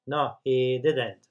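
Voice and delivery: slow model answers. Shown as a demonstration. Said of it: "Respuesta corta".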